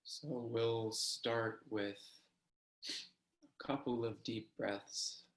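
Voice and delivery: slow, unhurried body-scan guidance; guided meditation. Acted. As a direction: lead them gently into the practice, leaving long pauses.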